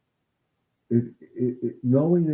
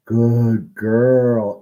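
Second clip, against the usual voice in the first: louder, bright praise; praising the dog; 9 dB louder than usual. Shown as a demonstration. Trainer shows owners how to praise the calm dog, loud and happy. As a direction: reward her out loud. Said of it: "And you praise her".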